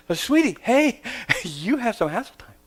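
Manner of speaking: high, playful sweet-talk; sweet high coaxing. Retold or imitated. Dad sweetly telling daughter she has hassle time. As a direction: coax a little girl.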